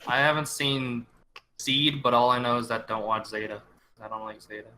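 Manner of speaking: usual speaking voice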